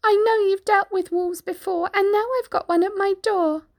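high piggy voice, worried; little pig, pleading. Acted. Pig begs for help.

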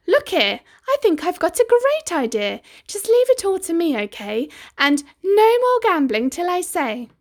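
put-on high, loud girlish voice; bright bossy young girl. A narrator's reading.

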